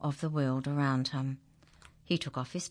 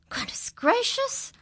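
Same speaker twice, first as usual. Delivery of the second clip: much higher astonished cry; surprised; 5 dB louder than usual. A narrator's reading. Narrator amazed that the farmer smiles. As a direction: gasp it out in wonder.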